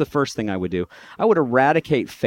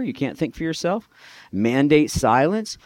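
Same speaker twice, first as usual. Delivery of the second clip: ordinary delivery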